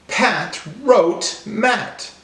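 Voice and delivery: slow and high, each word separate. Shown as a demonstration. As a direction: land clearly on each final T. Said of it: "they all end with the same sound".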